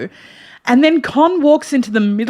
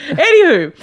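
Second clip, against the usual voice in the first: usual speaking voice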